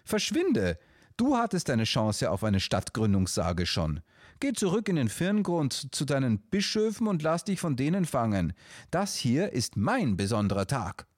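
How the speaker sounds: high, scolding bird voice; scolding dove. Acted. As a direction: tell the moose off sharply. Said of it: "schimpfte Till".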